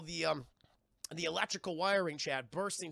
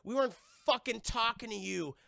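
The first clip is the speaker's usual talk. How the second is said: raised, higher contemptuous retort; mock angry Hammerhead; 4 semitones higher, 5 dB louder than usual. Aggressive personality talking back to police, mock angry. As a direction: spit it out, punching the last word.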